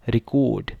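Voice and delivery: Record with the stress moved to the end, re-CORD; stress on second syllable. Shown as a demonstration. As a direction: say record as the verb, last syllable punched. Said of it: "जब as a verb use करेंगे". (Record दूसरे हिस्से पर ज़ोर)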